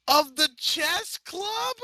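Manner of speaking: slow, high incredulous screech. A narrator's reading. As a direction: squeal it out in disbelief. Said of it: "Vinyl screeched".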